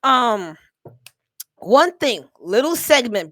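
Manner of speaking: loud, drawn-out showman intro; hyped announcement. Real, not acted. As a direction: announce it like a host, full volume.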